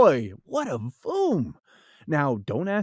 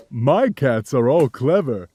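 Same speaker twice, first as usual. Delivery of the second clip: low, laughing cat voice, a touch louder; 6 semitones lower, 6 dB louder than usual. Acted. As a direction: chuckle through the cat's boast. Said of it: "And the big cat laughed".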